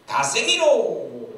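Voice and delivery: high, loud yell with a long tail; shouted command. Acted. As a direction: holler the order across the trenches, stretched out. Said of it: "하고 소리를 쳤습니다".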